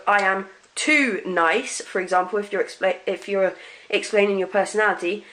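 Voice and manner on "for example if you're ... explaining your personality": ordinary talk, nothing marked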